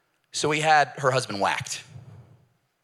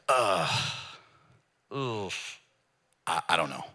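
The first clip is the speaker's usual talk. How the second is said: sheepish uncertainty; 7 semitones lower, 6 dB quieter, slower than usual. Retold or imitated. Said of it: "criminal's like".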